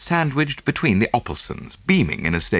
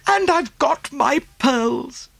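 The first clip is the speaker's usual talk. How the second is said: high, slow, satisfied woman's voice; Greek chorus; slower than usual. A narrator's reading. Mrs Oppelson chiming in like a chorus, high and slow. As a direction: chime in contentedly. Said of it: "as a sort of Greek chorus".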